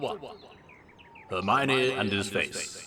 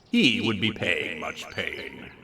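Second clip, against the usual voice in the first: mock ominous; 7 semitones lower, slower than usual. Dramatic reading of ominous line.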